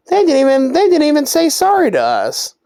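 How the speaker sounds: acted high-pitched disbelief; indignant. Two friends complaining that guards didn't say sorry, indignant. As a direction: whine it high, re-enacting the outrage. Acted outrage at getting no apology.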